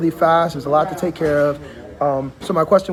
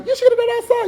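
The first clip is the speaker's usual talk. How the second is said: much higher, mocking voice of a know-it-all man; mock scolding quote. Host mocking a man telling a woman she should have stayed outside. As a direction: parody the guy lecturing her. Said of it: "be telling her".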